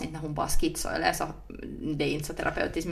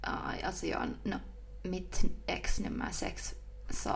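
ASMR whisper; 7 dB quieter than usual. Pretend. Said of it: "börja tala så här istället". (långsamt viskande på låtsas)

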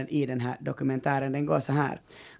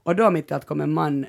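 normal talking voice

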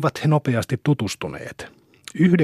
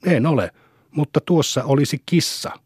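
plain reading voice

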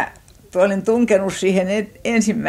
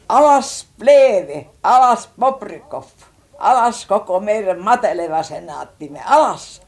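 drawn-out, high, loud cries of a protester; retold rallying shout; 4 semitones higher, 5 dB louder than usual. Young man at a rally imitated crying down the senate, shouting. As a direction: chant it out like a mock agitator. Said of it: "tämä mies huusi".

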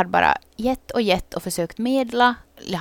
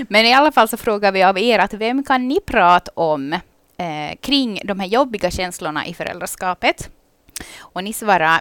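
usual speaking voice

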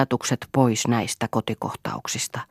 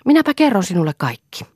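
offhand; 7 semitones higher, 6 dB louder than usual. A narrator's reading. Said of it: "niin kuin asia ei olisi tärkeää".

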